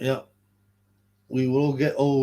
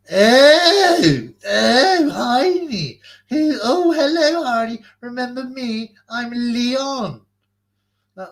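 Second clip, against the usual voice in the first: much higher and louder puppet calling out; a second puppet hails his friend, shrill and high; loud puppet greeting; 10 semitones higher, 6 dB louder, much slower than usual. Pretend. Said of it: "I'm gonna do a little puppet show".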